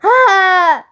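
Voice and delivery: short high, loud scream of horror. A narrator's reading. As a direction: scream at the ugly sight. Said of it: "That was me screaming".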